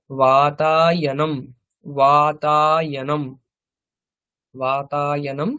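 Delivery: each syllable drawn out slowly; slow teacher model. Shown as a demonstration. Teaching Sanskrit words to the class, slow and deliberate. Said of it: "teacher models Sanskrit word".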